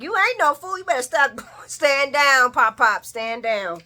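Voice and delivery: loud, high scolding; mock yelled warning; loud sassy mock. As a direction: order the old man to stand down. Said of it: "And I'm like".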